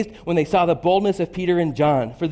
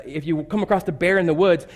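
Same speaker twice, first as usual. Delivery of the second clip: normal talking voice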